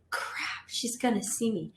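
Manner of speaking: whispered, breathless worry; a scared whisper to herself; hushed panic. Retold or imitated. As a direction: hiss it so nobody hears. Panicked thought, hiding from Kinsey.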